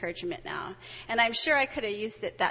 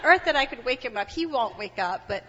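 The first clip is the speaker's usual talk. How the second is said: ordinary talk, nothing marked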